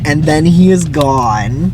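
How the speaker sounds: slow, drawn-out mock-dramatic delivery; milk the stage direction with slow, hammed-up gravity. Shown as a demonstration. Mocking the script's dramatic exit line, slowly hammed up. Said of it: "Such a dramatic exchange, followed by the stage direction".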